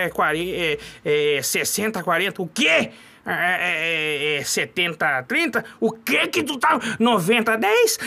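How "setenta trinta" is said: ordinary talk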